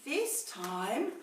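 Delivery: ordinary delivery, nothing marked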